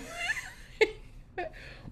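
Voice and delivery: a high squealing laugh. Real, not acted. Joking about Amazon addiction, high squealing laugh. Laughing at her own joke.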